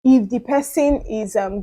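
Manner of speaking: ordinary talk, nothing marked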